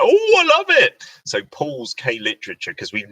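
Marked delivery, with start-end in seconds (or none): 0.0-1.0 high, loud squeal of delight; mock gushing. Imitating delight at the shiny new thing, gushing squeal. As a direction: squeal it like a hammed-up fan.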